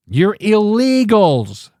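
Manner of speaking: ordinary talk, nothing marked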